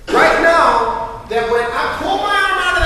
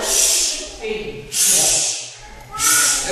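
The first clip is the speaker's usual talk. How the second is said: slow hissing shh, imitating spurting blood; blood spurting sound; 3 semitones lower, 5 dB quieter, much slower than usual. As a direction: mimic blood spraying with a drawn-out hiss. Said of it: "And blood went".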